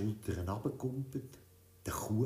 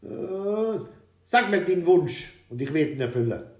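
louder, pompous king; king answering; 8 semitones higher, 10 dB louder than usual. A narrator's reading. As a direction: royal promise, a bit hesitant. (lauter, feierlicher König)

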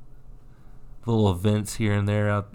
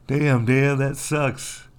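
higher, louder "Damn, Dan" with a mocking tone; mock sympathy; 4 semitones higher, 4 dB louder, slower than usual. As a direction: tease with a fake consoling exclamation. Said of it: "'Cause I was like".

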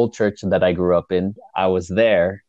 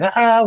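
high, louder mock scream; re-enacted yell; 5 dB louder than usual. Husband yelling in his sleep as wife wakes him, imitated high yell. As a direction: imitate your own sleep-yelling, pitch leaping up. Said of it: "So I'm just yelling".

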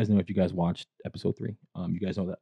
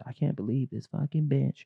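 higher, put-on snide voice; mocking friend's line; 5 semitones higher than usual. Friend mocks the rude line at the table, in a pitched-up snide voice. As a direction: mimic her catty remark, pitched up.

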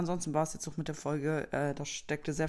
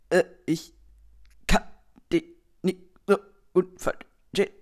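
chopped-up loud syllables with dropouts between them; superschlechtes Netz; 3 semitones higher, 9 dB louder than usual. Acted. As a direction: imitate a breaking-up phone connection, word by word. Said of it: "Der mit super schlechtem Netz". (abgehackt wie bei Funkloch)

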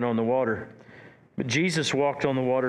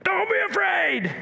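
shouted over screaming. Shown as a demonstration. Jesus shouting over terrified disciples, a full shout. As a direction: yell it over the panic of the crowd. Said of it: "screaming so much they couldn't hear him".

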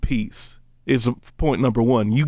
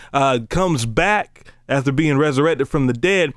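normal talking voice

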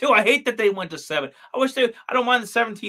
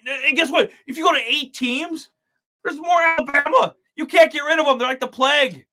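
higher, louder rant building up; excited rant; 7 semitones higher, 5 dB louder than usual. Real, not acted. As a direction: rant like a fired-up fan. Ranting about Alabama.

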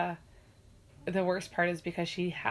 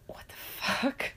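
slow stunned whisper; shocked whisper; 4 semitones higher, 3 dB quieter, much slower than usual. Retold or imitated. Mom watching daughter hit the floor, slow whisper. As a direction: breathe it out in disbelief, slowly. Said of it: "And I'm like".

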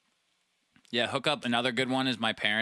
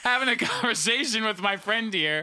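high, raised mock indignation; mock outrage; 9 semitones higher than usual. Real, not acted. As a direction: act spooked and indignant. Spooked, mock-outraged at friend.

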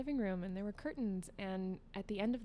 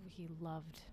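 whispering; 3 semitones lower, 9 dB quieter than usual. Real, not acted. Confiding aside about dad.

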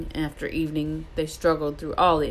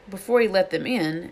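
plain reading voice

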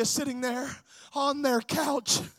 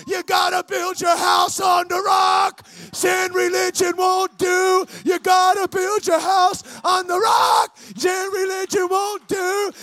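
loud, higher chanted shout, repeated insistently; Charlie Wycliffe; 4 semitones higher, 9 dB louder than usual. Retold or imitated. Evangelist preaching the same thing over and over, insistent repetitive shouting. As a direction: hammer out the same refrain in a strained preaching shout. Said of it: "preach the same thing over and over and over again".

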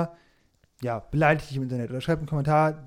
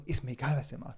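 quiet shrugging dismissal; dismissive mutter; 4 semitones lower, 11 dB quieter than usual. Retold or imitated. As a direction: throw it away, quietly uncaring. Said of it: "so dieses so".

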